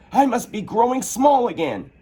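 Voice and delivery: put-on high, wondering girl's voice; young Alice. Alice shrinking, high and wondering. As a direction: marvel at the strange feeling.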